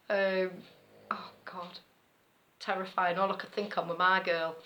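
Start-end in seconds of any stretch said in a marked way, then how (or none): 1.1-1.8 breathy whispered oh God; whispering. Real, not acted. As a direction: sigh it out under breath. Whispered relief.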